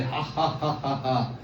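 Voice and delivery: imitated taunting laugh, low and soft; mock laugh. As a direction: fake a slow demonic ha-ha-ha. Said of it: "मेरे ऊपर हंस रही थी". (नकली, चिढ़ाने वाली हंसी की नकल)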